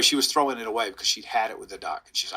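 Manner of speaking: ordinary talk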